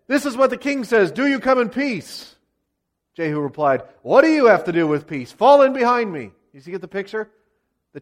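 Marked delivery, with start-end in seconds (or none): 0.1-2.4 high, formal herald's delivery; higher-pitched proclaimed question; royal messenger. Acted. Horseman delivers the king's message. 4.0-6.3 louder, forceful retort building into an order; imperious, raised voice; commanding rebuff. Acted. Jehu rebuffs and commands the horseman.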